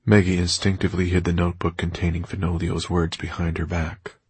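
ordinary delivery, nothing marked

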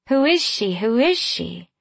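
a hint of singsong otherworldly curiosity; sweet fey voices. A narrator's reading. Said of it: "they sang with voices so sweet".

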